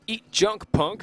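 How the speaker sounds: high, loud robotic quote; Junkbot robot voice. Retold or imitated. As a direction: chant it like a tiny battle robot. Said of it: "he says it in a robotic voice".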